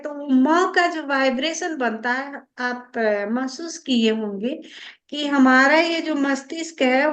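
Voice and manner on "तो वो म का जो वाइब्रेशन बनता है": ordinary delivery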